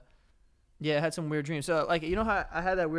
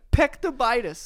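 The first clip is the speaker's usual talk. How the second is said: higher, louder gleeful repeat; amused repeat; 9 semitones higher, 7 dB louder than usual. Real, not acted. Repeating the dream disease name, gleeful. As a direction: say it again with delight. Repeats made-up word laughing.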